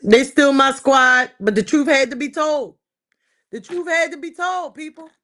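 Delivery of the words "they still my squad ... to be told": loud declaration. Real, not acted. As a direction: proclaim it loudly.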